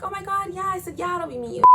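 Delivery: high, gushing mock agreement; imitated chirpy girlfriend call; fake-friendly phone chat. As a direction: gush it in a high pitch.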